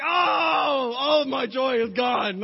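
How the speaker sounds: high, loud hammed-up sobbing cry; comic fake-crying shout, slowed down; mock crying wail. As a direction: wail it like your joy just died. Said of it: "I'm like".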